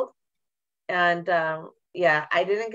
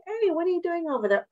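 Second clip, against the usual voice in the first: mock curious leader; 11 semitones higher than usual. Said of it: "be that curious leader, like".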